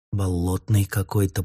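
usual speaking voice

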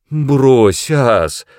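imploring, exclaimed plea; pleading. A narrator's reading. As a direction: beg him to relent. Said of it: "взмолился я".

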